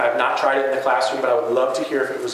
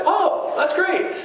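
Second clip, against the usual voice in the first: high, slow, drawn-out enthusiasm; teacher answering a student's birthday news, high and slow; hammed-up delight; much slower than usual. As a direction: stretch out the delight for the kid. Teacher's reply in retold exchange.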